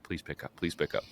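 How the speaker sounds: low desperate muttering; pleading under the breath; muttered pleading. Retold or imitated. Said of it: "I'm like".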